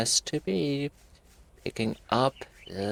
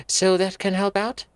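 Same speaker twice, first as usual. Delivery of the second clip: a touch higher, questioning Anne; voiced Anne line; 7 semitones higher, 4 dB louder than usual. Anne asking the groundkeeper, a touch higher. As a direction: read it as Anne.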